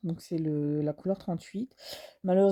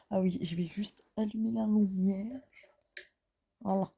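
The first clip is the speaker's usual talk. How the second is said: whispering; 4 dB quieter than usual. Real, not acted. Whispered aside while filming.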